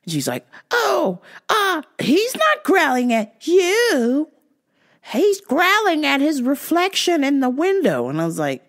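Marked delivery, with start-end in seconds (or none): 0.7-8.1 high, slow fussy lady; high mock lady voice. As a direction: plead like the flustered owner. Voicing the lady's excuse.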